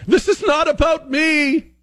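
high squeaky mock voice of a protester; hammed-up high voice, mocking her. Host mocking a protester in a high, hammed-up voice.

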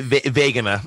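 "vagina" as "VAY-guh-nuh"; hard g and long "ay" in "vagina"; accented mispronunciation. Shown as a demonstration. Guest answering how they'd pronounce it, "vagina" said "VAY-guh-nuh". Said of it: "How do they pronounce vagina?".

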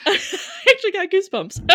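high, giggly and moved; laughing. Real, not acted. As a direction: laugh while you confess it. Touched, laughing at a compliment.

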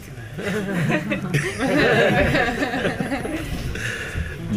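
long high laugh that fades; laughing hard at own joke. Real, not acted. Laughing after mocking clingy couples.

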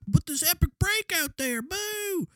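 very high whiny surfer voice. Retold or imitated. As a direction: whine it like a pouting surfer. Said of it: "Tyler was just like".

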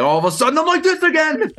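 very high, mock over-excited burst; suddenly hyped again. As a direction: shoot up into frantic camera energy. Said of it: "forty seconds later on my neck, I'm like".

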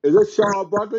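high starstruck question; disbelieving. Retold or imitated. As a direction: ask unable to believe it. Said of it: "I'm like".

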